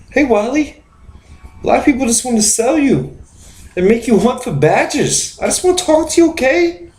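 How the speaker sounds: high-pitched Mark Wahlberg voice; Mark Wahlberg impression. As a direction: chirp it out like an eager star pitching himself. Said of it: "I'm Mark Wahlberg".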